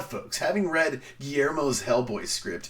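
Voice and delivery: ordinary talk, nothing marked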